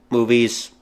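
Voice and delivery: ordinary talk, nothing marked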